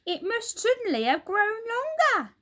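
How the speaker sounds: put-on very high, loud old-woman cry of alarm; shrill old woman panicking, pitched high; Missus Twit alarmed. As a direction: Missus Twit staring at her stick, alarmed. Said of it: "Missus Twit said".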